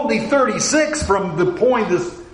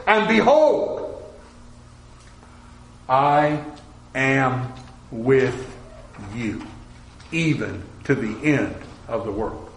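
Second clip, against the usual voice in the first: slow, low, solemn voice of Jesus; a lowered, grave promise from the Lord; Jesus speaking; 6 semitones lower, 4 dB quieter than usual. Retold or imitated.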